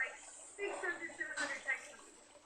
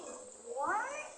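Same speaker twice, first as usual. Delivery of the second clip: ordinary talk, nothing marked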